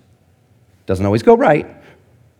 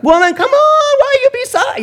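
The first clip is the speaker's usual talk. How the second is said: squeaky high, louder exasperated complaint; exasperated outburst; 4 dB louder than usual. Retold or imitated. Fed up with the mayor over taped swings, exasperated squeal. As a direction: throw up your hands at the mayor. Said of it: "sometimes I'm like".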